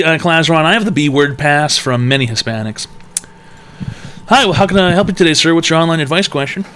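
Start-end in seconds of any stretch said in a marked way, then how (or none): 4.3-6.6 host voice. Acted. Host intro voice.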